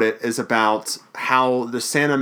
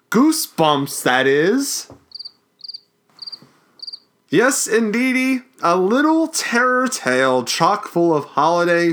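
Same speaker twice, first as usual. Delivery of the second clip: high, slow, hammed-up ghoulish host; dramatic Crypt Keeper reading; 4 semitones higher, slower than usual. Host reading the Crypt Keeper's intro to his cohost, hammed-up and slow. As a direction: ham up the spooky holiday greeting, drawn out. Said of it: "dramatic reading".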